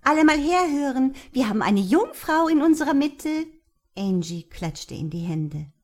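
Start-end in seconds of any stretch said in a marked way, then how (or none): 0.0-3.5 loud, high party announcement; calling out. Acted. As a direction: call out to the whole room.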